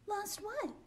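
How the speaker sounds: high surprised lost what; curious little girl voice; surprised question. Acted. Olive asks surprised.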